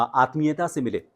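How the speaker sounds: usual speaking voice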